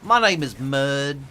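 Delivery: slow, drawn-out southern drawl. Shown as a demonstration. Introducing himself to chat in a slow drawl. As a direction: stretch every vowel in a lazy drawl. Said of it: "as much of a drawl as I could get".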